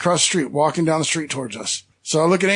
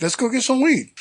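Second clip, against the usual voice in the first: higher-pitched, keen agreement; mock-eager quote of himself, pitched up; eager echo; 6 semitones higher than usual. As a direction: echo the idea back with rising enthusiasm, then drop. Eagerly echoing the buddy's invitation.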